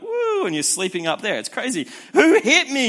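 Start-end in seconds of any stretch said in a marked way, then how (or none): 0.0-0.5 put-on high, loud whoop like a drunk sailor; drunken "woo" yelled up high; mock drunk whoop. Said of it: "you're like". 2.1-2.9 much higher, loud, confused cry; a drunk's loud, dazed shout; mock drunk yell. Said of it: "you'll say".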